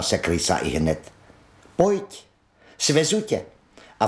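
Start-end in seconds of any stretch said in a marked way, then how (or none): 1.8-3.4 eager invitation. Acted. Rat eagerly invites Mole aboard.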